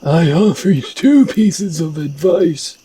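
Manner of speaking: high, theatrical wise master; high-pitched mystical mentor; shaman teacher character voice. Acted. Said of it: "I like that voice better for this character".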